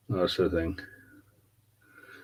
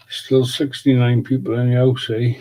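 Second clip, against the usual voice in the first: excited; 5 semitones higher, 5 dB louder than usual. Real, not acted. Reacts to viewer count.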